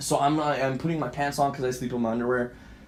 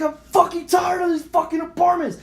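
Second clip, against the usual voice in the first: high, loud fed-up shout; retold screaming and yelling; 14 semitones higher, 5 dB louder than usual. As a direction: scream it out in re-enacted rage. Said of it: "And I'm screaming and yelling".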